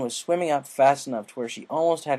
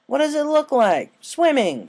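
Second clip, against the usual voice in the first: cheeky Rainbow; 14 semitones higher, 7 dB louder, slower than usual. Acted. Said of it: "she greeted".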